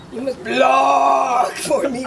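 put-on slow, loud Greek-accented command; Arianna Huffington impression. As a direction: stretch every word in a thick Greek accent. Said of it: "with that accent".

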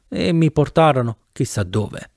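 usual speaking voice

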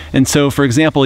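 ordinary talk, nothing marked

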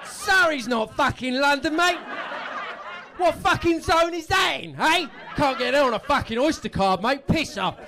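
loud, slow mock-Cockney rant; hammed-up angry Cockney; mock outrage. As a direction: play the outraged Londoner, shouting. Comic mock Cockney outrage at audience.

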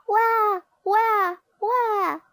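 high, loud storybook "wah" crying; mock baby wail. A narrator's reading. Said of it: "she cried".

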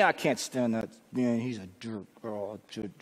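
low complaint trailing off under the breath; muttered grumbling. Acted. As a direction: imitate a grumbler, very low and soft. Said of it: "who wants to be around".

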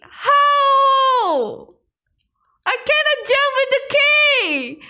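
high exasperated cry; frustrated. Real, not acted. Gamer unable to jump with key, high frustrated wail. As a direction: wail in protest, stretching HOW.

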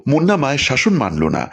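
plain reading voice

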